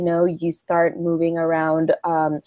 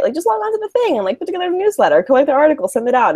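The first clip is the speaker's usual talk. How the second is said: high, rattled-off imitation of easy steps; mock breezy; 9 semitones higher, much faster than usual. Guest imitating people who think newsletters are easy, breezy. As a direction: toss it off as if anybody could.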